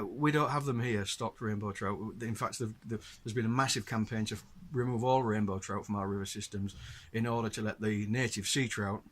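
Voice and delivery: ordinary talk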